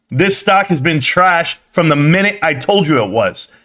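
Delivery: usual speaking voice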